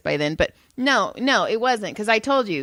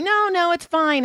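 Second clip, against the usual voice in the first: dismissive; 9 semitones higher than usual. Retold or imitated. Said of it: "she's like".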